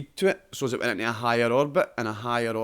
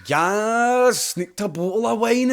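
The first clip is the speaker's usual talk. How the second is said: high, loud, triumphant boast; much higher and louder cheeky glee; gleeful astronaut; 10 semitones higher, 6 dB louder, slower than usual. Pretend. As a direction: crow it like a sneaky drunk spaceman. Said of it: "two Scottish astronauts... they're just like that".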